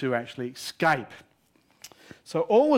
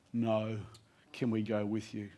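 hushed, much softer, leaning in; whispered; 4 semitones lower, 9 dB quieter than usual. Retold or imitated. Soldiers leaning in, asking softly to come along. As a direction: drop to a soft secretive murmur, not a true whisper. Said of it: "leaned forward and whispered".

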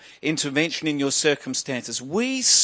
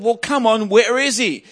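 higher-pitched, impatient pressing; insistent priest; 7 semitones higher, 4 dB louder than usual. Acted. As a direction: press her for an answer, voice raised in pitch. Priest presses wife for husband's whereabouts.

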